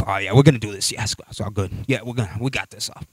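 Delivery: low, quiet macho boasting; mock tough-guy bravado. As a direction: play the buff guy acting unbothered. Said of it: "big men and they're all tatted up".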